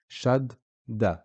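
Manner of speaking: "Shadda" with the d held long, doubled; doubled consonant demo. Shown as a demonstration. Teacher models the doubled d for students, deliberate. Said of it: "you can hear the dal being pronounced twice".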